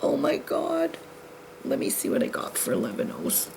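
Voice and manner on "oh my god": mock dismay. Real, not acted. Dismay over missing bead color.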